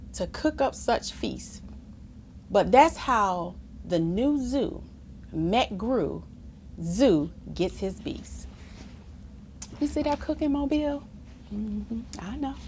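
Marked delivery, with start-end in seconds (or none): none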